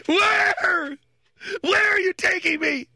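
high, loud mock wail of a crying girl; hammed-up child bawling. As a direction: imitate a sobbing little girl, high and loud. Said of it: "she broke down in tears, bawling, crying, wailing".

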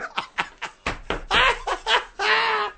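high-pitched laughing burst. Real, not acted. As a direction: cackle high and long. Laughs after his own joke.

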